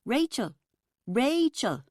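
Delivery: calling out across a crowded room; shouting a name. A narrator's reading. Helen spotting Rachel across the bar, calling out. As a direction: call her name over the noise. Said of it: "I heard someone shout".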